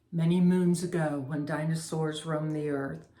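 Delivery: ordinary delivery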